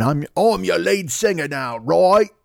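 mock Cockney tough, rising on the last word. Singer bullying his bandmate, put-on Cockney swagger. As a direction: throw your weight around in broad mock Cockney. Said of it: "[name] just basically beat up".

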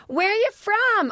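very high, slow mocking voice; Lyft driver. Lyft driver interrupting her work call, mocking high voice. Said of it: "the Lyft driver's like".

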